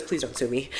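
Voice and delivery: low, quiet begging; pleading. Retold or imitated.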